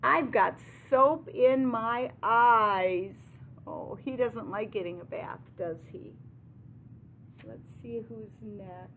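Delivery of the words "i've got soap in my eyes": loud, high, slow storybook wail; hammed-up cow complaint; complaining cow. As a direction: cry out a drawn-out protest as the cow. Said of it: "the cow cries".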